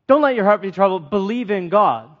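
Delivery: brisk, matter-of-fact pass through the line; the preacher rereads the verse to explain it, quick and plain; quick re-reading. Shown as a demonstration. Run through it fast as a reminder. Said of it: "another way of saying is".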